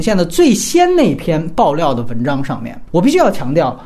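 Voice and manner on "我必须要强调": normal talking voice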